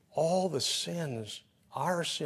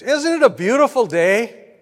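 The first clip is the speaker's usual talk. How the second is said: higher and louder, lightly voiced sunny lady; cheerful passerby; 8 semitones higher, 11 dB louder than usual. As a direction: gush it like a happy stranger. Cheerful lady passing on walk.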